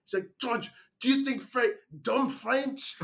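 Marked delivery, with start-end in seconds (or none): 0.4-1.8 high-pitched mock French accent; hammed-up French teacher's accusation. Teacher confronting student over insulting title, French accent. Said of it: "I'm gonna do the French voice for her". 2.0-2.9 high-pitched mock French accent, laughter creeping in. Teacher reading out student's insulting title, French accent. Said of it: "I'm gonna do the French voice for her".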